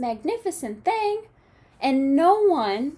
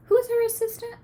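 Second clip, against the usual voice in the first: quiz question; 11 semitones higher, faster than usual. Real, not acted. Teacher asking her students a question, high and sing-song. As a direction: coax the class to answer.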